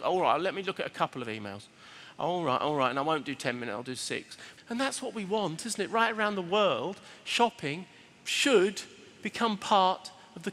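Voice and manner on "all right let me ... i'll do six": low, resigned self-talk; mock reluctant climb-down; grudging giving in. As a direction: bargain with yourself, dropping lower. Acts giving in to emails.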